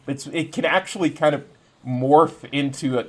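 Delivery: ordinary delivery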